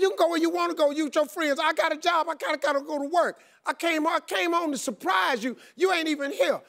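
high-pitched comic rant; letting her have it. Retold or imitated. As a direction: act out a husband's indignant tirade. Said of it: "I was letting her have it".

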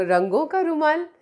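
usual speaking voice

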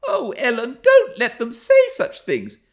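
put-on high, loud girlish plea; greatly troubled. A narrator's reading. Young Cathy begging Ellen to stop them, high distressed plea. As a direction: plead in distress like a child. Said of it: "she pursued in great trouble".

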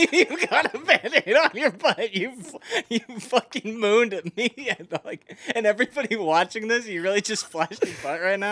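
giggling through the words; laughing. Real, not acted. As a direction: laugh while talking. Laughing at friend's butt.

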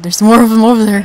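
loud alarmed shout. Real, not acted. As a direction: call out the danger loudly. More wolves appear.

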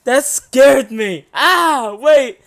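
loud, high, slow shaken exclaim; shaken yelling. Real, not acted. Streamer recovering from the jump scare, loud and shaken. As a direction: still rattled, say it loud.

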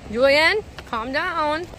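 slow, loud call to calm down. Real, not acted. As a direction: call him back firmly. Telling a kid to calm down.

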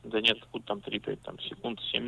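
ordinary talk

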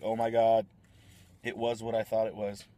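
imagined future self, loud burst of shock; mock excited realization. Vlogger imagining looking it up later, loud mock shock. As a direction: act out the moment the answer dawns. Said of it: "I'll look it up later and be like".

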